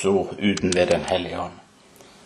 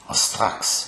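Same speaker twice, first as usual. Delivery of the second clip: whisper. Acted. Climax of healing story, hushed.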